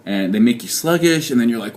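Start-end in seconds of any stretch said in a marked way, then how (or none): none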